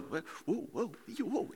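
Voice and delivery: soft, mock-startled wobble; hushed comic "whoa" of alarm. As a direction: bystander caught off guard. Acts out startled John.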